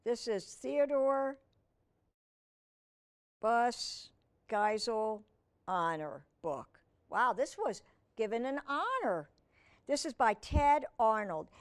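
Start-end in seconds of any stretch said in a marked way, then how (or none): none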